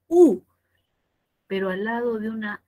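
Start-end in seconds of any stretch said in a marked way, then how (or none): 0.1-0.4 "U" high and very loud; stressed U. Shown as a demonstration. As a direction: punch out "U" as the tonic vowel. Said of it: "con mayor fuerza de voz".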